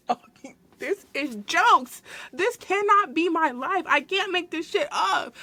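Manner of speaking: high, slow disbelief through laughter; stunned laughing. Real, not acted. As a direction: drag it out, laughing in shock. Stunned by customer collapse.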